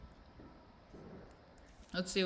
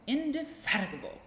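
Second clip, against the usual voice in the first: indefatigable with FAT punched high; relished emphasis; 9 semitones higher than usual. Shown as a demonstration. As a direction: savour indefatigable, high leap then a fall. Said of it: "I love saying it".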